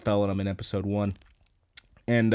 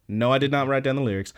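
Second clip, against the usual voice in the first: usual speaking voice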